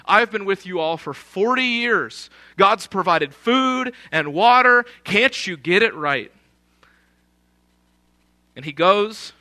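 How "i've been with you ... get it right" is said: acted exasperation, swelling louder and higher midway; frustrated, angry. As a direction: scold a grumbling crowd as a fed-up leader. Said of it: "and he's frustrated".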